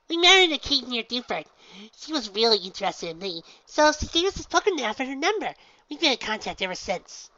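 very high, loud bubbly pony voice; Pinky, exclaiming. Acted. Pinky introduces herself to the gym leader, very high and bubbly.

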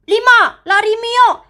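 sudden high cry of go; urgent shout. Acted. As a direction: shout for her to bolt. Kids bolting on count.

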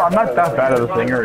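usual speaking voice

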